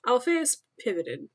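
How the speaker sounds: plain reading voice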